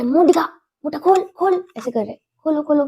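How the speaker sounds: a father insisting she open her mouth; insistent father. Retold or imitated. (ज़िद करते पापा)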